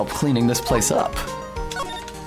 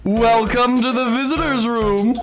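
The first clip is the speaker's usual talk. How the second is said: magician; 6 semitones higher than usual. Acted. Voicing Max, magician.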